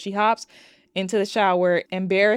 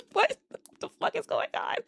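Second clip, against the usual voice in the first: laughing disbelief; 6 dB quieter than usual. Real, not acted.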